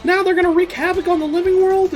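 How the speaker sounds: hammed-up high panic; panicked Koenma. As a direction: shriek the news in a total panic. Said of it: "Koenma's panicked".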